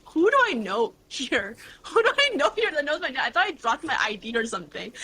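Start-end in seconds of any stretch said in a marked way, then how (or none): 0.1-3.2 high-pitched bewildered question, half laughing; baffled disbelief. Retold or imitated. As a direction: replay being puzzled, amused by it. Acting her confused reaction to recognition.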